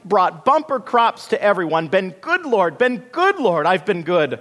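high, loud, desperate pleading; shrieking protest. A narrator's reading. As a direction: plead your case at a frantic high pitch. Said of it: "shrieked Bean Plant".

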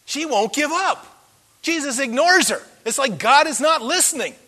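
normal talking voice

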